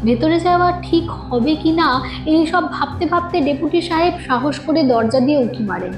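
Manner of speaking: plain reading voice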